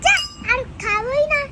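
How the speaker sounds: loud, huffy refusal; sulky refusal. Real, not acted. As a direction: refuse in a huff. Child refuses in a huff.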